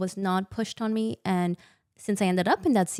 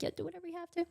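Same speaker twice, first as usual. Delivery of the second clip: high, soft airy mock of a careless patient; mocking passive patient; 11 semitones higher, 11 dB quieter, much faster than usual. Woman mocking patients who never question doctors, high and soft. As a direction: play the shrugging patient who asks nothing.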